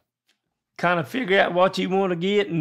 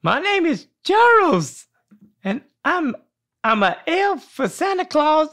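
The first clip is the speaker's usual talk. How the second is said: elf voice; 9 semitones higher than usual. Acted. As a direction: pitch way up like a little elf. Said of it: "you gotta have an elf voice".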